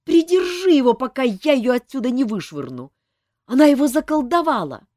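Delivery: high, loud frantic yelling; frantic shout. A narrator's reading. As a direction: scream to hold him back.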